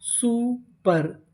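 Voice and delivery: Super very slowly, two separate syllables. Shown as a demonstration. Teacher starts building a long word, super slowly in pieces. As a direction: stretch and split super. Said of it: "तो meaning क्या बनी?".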